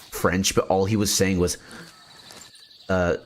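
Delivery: ordinary delivery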